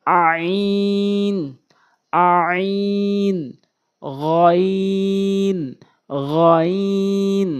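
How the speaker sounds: each Arabic letter held long; letters chanted slowly and clearly; drawn-out letter names. Shown as a demonstration. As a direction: recite each letter slowly, syllables stretched.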